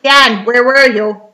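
loud, drawn-out mock demand; mock scolding wife. Wife to Dan as he comes home, mock scolding. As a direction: lightly act a wife calling him to account.

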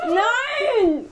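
high, loud disgusted no; disgusted shriek. Real, not acted. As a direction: shriek in disbelief. Reacting to disgusting bean taste. (angeekelter Aufschrei)

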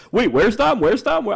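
very high, loud questioning; mock panic. Group notices Dom is missing at rehearsal, mock panic. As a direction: imitate a flustered group asking urgently. Imitates others searching for missing friend.

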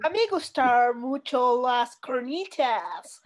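mock gringo Spanish. Friend mocks confident American Spanish learners in a gringo accent. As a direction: ham up an American mangling Spanish. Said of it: "como si fuera lo más chingón del mundo".

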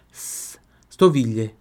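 stoviglie slow, S stretched as a hiss; soft S breath. Shown as a demonstration. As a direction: draw out the S of stoviglie. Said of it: "Só o soprinho". (stoviglie com o S soprado e lento)

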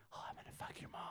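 imitating an ASMR gamer's whisper. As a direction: whisper it right into the mic, breathy and close. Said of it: "this guy doing an A S M R".

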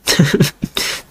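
breathy airy chuckle; soft laugh. Real, not acted. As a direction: laugh quietly at yourself. Laughs at own rant.

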